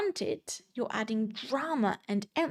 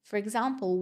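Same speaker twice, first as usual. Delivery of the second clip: normal talking voice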